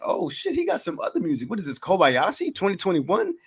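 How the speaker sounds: high, excited disbelief; amazed listener. Pretend. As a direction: gasp it out like a stunned fan. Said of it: "And you be like".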